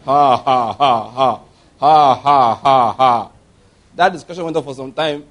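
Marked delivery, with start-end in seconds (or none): 0.0-3.3 dry forced laugh. Retold or imitated. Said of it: "So he began to laugh".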